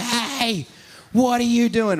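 high, loud mock confrontation; pretend yell. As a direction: shout him down in a staged fight. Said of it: "we have this pretend fight".

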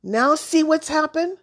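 high, loud appeal; calling out. A narrator's reading. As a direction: call it through to the next room, pitched high. Boy calling to grandfather after spill.

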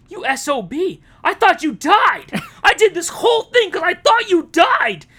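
mock angry shout at Bruce; upset shout. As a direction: yell it at him betrayed. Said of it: "Joseph Gordon-Levitt is just like".

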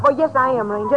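ordinary delivery

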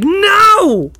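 high mock wail; exasperated protest. Directors hearing everyone chose British accents, high exasperated protest. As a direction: cry out in hammed-up dismay.

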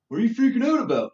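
mock everyone's baffled, hurried pushback; incredulous retort. Quoting everyone brushing it off, quick and incredulous. Quotes everyone's startled reaction.

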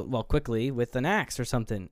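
usual speaking voice

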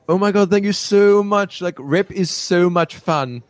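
put-on high, gushing Australian accent. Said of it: "I can't do an Australian accent, but she does the whole".

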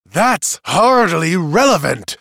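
high, loud, drawn-out objection; indignant shout. Acted. Accuser objects to the testimony, shouting. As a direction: shout it down in outrage. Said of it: "shouted Lowenval".

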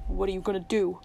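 low, hoarse question. A narrator's reading. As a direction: ask it hoarsely, keeping it down. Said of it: "Tuck said hoarsely".

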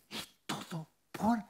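slow, intense whisper; awed stage whisper; hushed emphasis. Real, not acted. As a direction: whisper each word deliberately.

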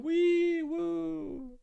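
high wee-woo siren; siren noise. Pretend. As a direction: mimic a siren going off. Siren noise after historical context.

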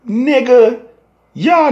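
high loud exasperated call. Real, not acted. Scolding gang members over politics, high exasperated call. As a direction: bark it up high in disbelief.

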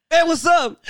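high, bright-voiced hey what's up; put-on high greeting to a coworker; mock cool greeting. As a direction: play it up like a goofy greeting.

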